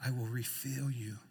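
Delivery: tender reassurance. Acted. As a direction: speak gently to someone who is tired. Consoling weary believers with God's words.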